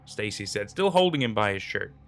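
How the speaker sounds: plain reading voice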